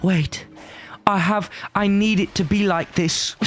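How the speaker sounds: put-on high, pleading Victorian child. The little boy inside him begging, a high Victorian child's voice. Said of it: "The dream of the little boy inside me saying".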